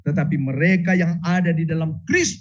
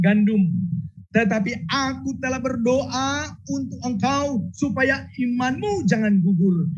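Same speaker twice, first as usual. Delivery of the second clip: voicing Jesus; 8 semitones higher than usual. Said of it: "dia katakan".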